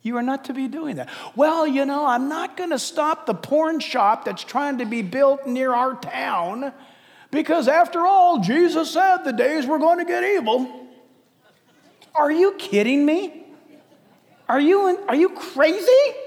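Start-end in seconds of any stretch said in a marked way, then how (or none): 1.3-10.7 put-on voice of an excuse-maker; mocking an excuse. Pastor mimicking Christians who won't get involved, mocking put-on voice. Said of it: "But the way you put it". 12.2-16.2 high, exasperated disbelief; incredulous rebuke. Acted. Pastor to complacent Christians, high incredulous questioning.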